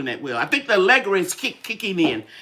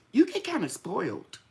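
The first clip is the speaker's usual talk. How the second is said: normal talking voice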